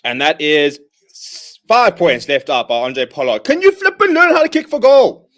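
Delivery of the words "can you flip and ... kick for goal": high, loud, frustrated yell at the kicker; a fed-up fan shouting at the screen; exasperated outburst. Real, not acted. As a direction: vent at the kicker in disbelief. Fan exasperated at a missed kick.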